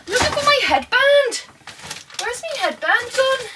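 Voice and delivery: very high, squeaky mock alarm; mock panic. Mock panic over lost headband.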